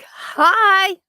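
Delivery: high, loud mock hello; imitated greeting. Streamer reading Canadian Witch's hello aloud, imitated greeting. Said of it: "She's like".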